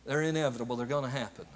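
ordinary talk, nothing marked

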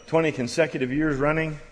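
ordinary delivery

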